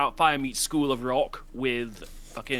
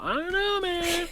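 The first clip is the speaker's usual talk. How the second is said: high, put-on clueless voice; shrugging Voldemort; slower than usual. Husband shrugs at his wife in a silly high voice. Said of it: "shrugging Voldemort".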